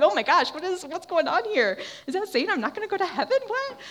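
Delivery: loud, high-pitched mock alarm; acted shocked disbelief. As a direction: play a listener totally taken aback. Voicing a taken-aback listener.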